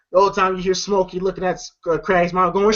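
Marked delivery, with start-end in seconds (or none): none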